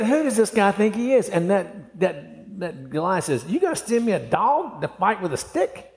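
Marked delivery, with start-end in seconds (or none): 0.0-1.3 high-pitched scornful question; defiant, pitched up; acted indignant challenge. Repeats David's challenge to Goliath. 3.5-5.9 high-pitched scornful question; pitched-up taunting disbelief; mock incredulity. Acts out Goliath's scornful question.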